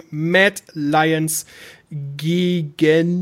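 drawn-out, deliberately slow words; slow right down, word by word. Real, not acted. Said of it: "jetzt muss ich ganz langsam reden". (ganz langsam gesprochen)

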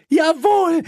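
very high, outraged cry; hysterical screech. A narrator's reading. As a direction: screech it defiantly. Hysterical woman keeps screaming. (hysterisch gekreischt)

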